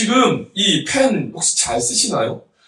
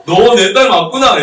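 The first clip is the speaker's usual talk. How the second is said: raised, louder retold exclamation; mock exclamation to daughter; 5 semitones higher, 10 dB louder than usual. Father retells recognizing his crying daughter, loud playful exclamation. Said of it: "이렇게 제가 이야기한 적이 있습니다".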